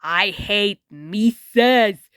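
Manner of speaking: slow, put-on cartoon cat voice; Jinks the cat quote. A narrator's reading. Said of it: "quoting other famous cartoon characters".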